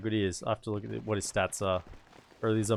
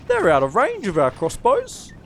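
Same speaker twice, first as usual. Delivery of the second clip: confused soldier voice; 10 semitones higher, 8 dB louder than usual. Acted. Soldier objecting to his trainer.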